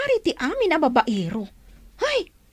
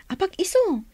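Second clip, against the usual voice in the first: whisper; 6 semitones higher than usual. Acted. Whispered aside.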